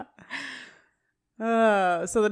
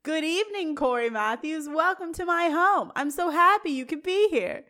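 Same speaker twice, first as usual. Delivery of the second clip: mock yell up stairs; 7 semitones higher than usual. Melissa welcomes Corey for upstairs to hear, mock yell. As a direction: call upstairs in a raised pitch without shouting. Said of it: "just yells up the stairs".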